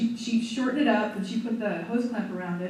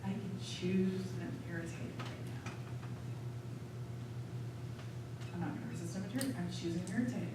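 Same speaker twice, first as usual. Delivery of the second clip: soft, low self-talk; quiet inner voice; 5 semitones lower, 10 dB quieter than usual. Retold or imitated. Telling the group her private thought, quietly calming herself. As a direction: murmur it to yourself as a realization. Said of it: "You guys heard the internal dialogue".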